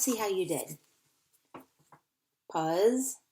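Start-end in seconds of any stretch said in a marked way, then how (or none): 2.5-3.2 "Puzzle" cut after a rising "puz", long pause, then "zle"; split into syllables. Shown as a demonstration. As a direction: break "Puzzle" into two separate syllables. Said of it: "gonna mark my syllables".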